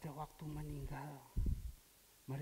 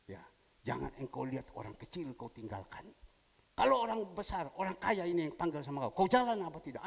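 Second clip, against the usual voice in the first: loud stern divine rebuke; 8 dB louder than usual. Retold or imitated. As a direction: rebuke him sharply. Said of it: "Tuhan bilang".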